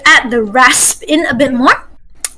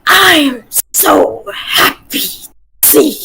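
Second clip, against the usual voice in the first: slow, rasped-out gruff demo; a teacher demonstrating a raspy dwarf; much slower than usual. Shown as a demonstration. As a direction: drag the S sounds with rasp. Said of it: "I can't go as low as you".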